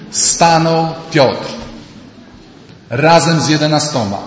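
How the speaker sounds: slow, loud declaiming of Stanął Piotr; booming scripture reading; solemn declamation. Acted. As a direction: proclaim it like a herald. Preacher declaims scripture slowly.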